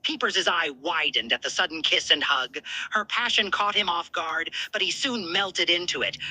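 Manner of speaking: plain reading voice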